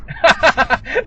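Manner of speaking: loud, high burst of laughter. Real, not acted. Driver laughing at own sarcasm about signaling, loud laugh.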